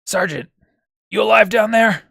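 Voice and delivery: loud, urgent check on a sergeant; commander calling down. Acted. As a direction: holler down to a soldier below. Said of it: "he says".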